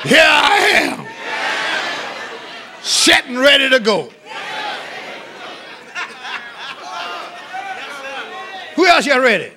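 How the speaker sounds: high, loud revival preaching with stretched-out phrases; full-force preaching. Real, not acted. Preacher leading a congregation to answer the Lord, exultant shout. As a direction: declare it in triumph, letting each phrase ring.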